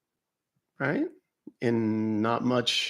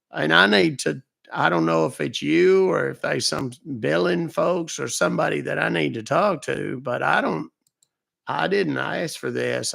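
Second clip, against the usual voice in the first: put-on old man Lou; 4 semitones higher than usual. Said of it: "He was questioning my voice".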